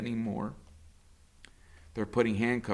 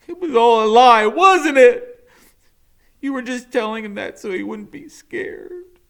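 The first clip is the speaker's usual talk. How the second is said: high and very loud, upset; angry accusation; 12 semitones higher, 14 dB louder than usual. Acted. Max accusing the doctor of lying, high and loud. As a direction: shout the betrayal at her.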